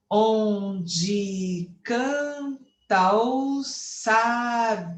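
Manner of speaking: very slow, drawn-out syllables; escansão poética. Shown as a demonstration. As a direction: scan the line beat by beat through to the final "sabiá". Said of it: "Por que que eu fui até a última sílaba?". (escandindo bem devagar, sílaba por sílaba)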